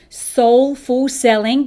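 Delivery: drawn-out slogan "soulful selling"; slow emphasised phrase. Shown as a demonstration. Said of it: "that I like to say it".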